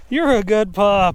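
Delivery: loud, high, slow dog praise; praising the dog. Real, not acted. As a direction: cheer the pup on.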